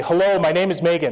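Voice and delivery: half-strength yell, pitch lifted; mild demo yell. Shown as a demonstration. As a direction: call it out for the volunteer to copy. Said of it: "can you yell for me".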